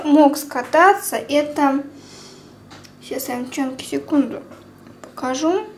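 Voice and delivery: ordinary delivery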